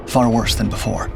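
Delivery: ordinary delivery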